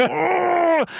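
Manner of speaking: high, loud "ugh" of effort; long imitated groan of gym misery; strained gym groan. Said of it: "I saw myself going".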